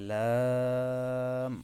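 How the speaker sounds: লাম held long and drawn out very slowly; Arabic letter demo. Shown as a demonstration. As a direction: stretch the sound of লাম. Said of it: "কিছু সময় দীর্ঘ করে টানতে হবে". (লাম দীর্ঘ করে টেনে উচ্চারণ)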